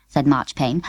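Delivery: plain reading voice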